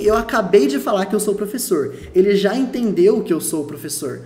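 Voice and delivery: normal talking voice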